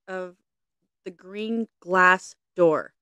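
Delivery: slow, each word weighted; slow hint. Acted. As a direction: draw out the riddle's key phrase. Hinting at a riddle.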